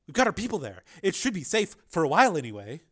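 Sharp, informative. Acted. Sharp reassures the group.

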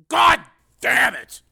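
high, very loud, slow outburst; furious curse. A narrator's reading. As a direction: explode in frustration, word by word. Marshal curses the failure.

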